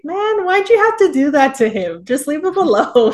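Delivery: mock scolding. Telling her to leave him alone, high mock scolding.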